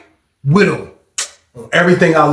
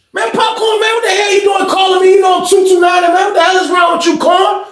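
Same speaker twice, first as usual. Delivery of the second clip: snapping at him. Retold or imitated. Walking into the pod, he snaps at Popcorn with a high retold yell. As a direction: blow up at him the second you walk in. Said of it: "I snap on Popcorn ass".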